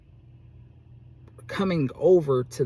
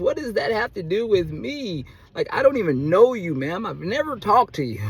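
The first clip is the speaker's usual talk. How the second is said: loud, high, exasperated confrontation retold; indignant retort; 7 semitones higher, 6 dB louder than usual. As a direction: push back at her, raised and incredulous. Retelling his angry reply to her.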